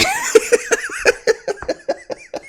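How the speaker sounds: real high-pitched burst of laughter; helpless giggling fit. Real, not acted. Breaks character laughing.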